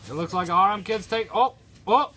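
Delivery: building to a loud, high wrestling call; wrestling commentator. Acted. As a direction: call the big move like a commentator. Live play-by-play of a match.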